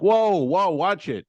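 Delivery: startled passenger calling out; alarmed warning, retold. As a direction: re-enact warning a drifting driver. Warning the drifting driver, retold.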